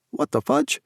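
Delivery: high, baffled squeak; surprised. Acted. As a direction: pitch it up in disbelief. Confused at more paper.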